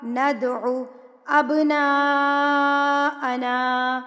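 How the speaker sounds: slow, drawn-out chanted recitation; Quranic recitation. Shown as a demonstration. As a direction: recite with tajweed, long and measured. Recites the verse. (تلاوت کا انداز)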